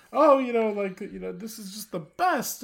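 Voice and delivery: high-pitched, gushing imitation of excitement; mock excitement. Imitating the film's heroine over her pin, mock excited. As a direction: gush like a thrilled girl, mockingly. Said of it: "how excited she is".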